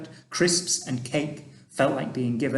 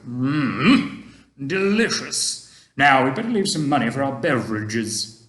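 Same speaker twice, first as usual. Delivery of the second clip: put-on a kindly old gentleman, louder and gently advising; Mister Stink; 5 dB louder than usual. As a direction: speak like an old tramp giving fatherly advice. Mister Stink finishing his drink.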